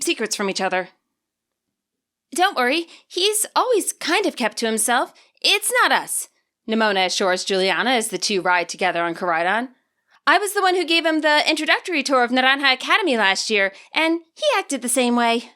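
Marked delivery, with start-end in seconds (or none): 2.3-6.3 higher, upbeat schoolgirl voice, reassuring; Nimona, reassuring. Acted. Nimona assures Juliana on Koraidon, upbeat and higher. 10.2-15.5 higher, upbeat schoolgirl voice, reassuring; Nimona, reassuring. Acted. Nimona assures Juliana on Koraidon, upbeat and higher.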